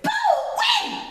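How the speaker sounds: very high, loud whoop at scalding porridge; hot porridge yelp. Acted. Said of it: "Papa Bear tastes this porridge".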